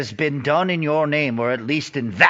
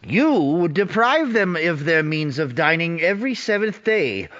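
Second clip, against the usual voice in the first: rising indignation; 7 semitones higher than usual. Acted. Scrooge challenges the spirit.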